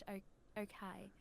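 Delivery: "okay" in a not quite British accent, ending like "kye"; attempted British "okay". Shown as a demonstration. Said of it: "it's not quite British".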